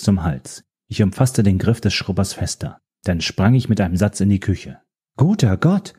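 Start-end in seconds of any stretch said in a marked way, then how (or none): 5.2-5.9 high startled cry of fright; startled scream. Acted. Startled woman in the kitchen cries out, high and frightened. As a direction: shriek it, caught off guard. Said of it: "schrie die Frau in meiner Küche".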